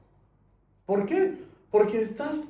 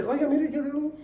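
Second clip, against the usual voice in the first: mock pushy seller; 10 semitones higher than usual.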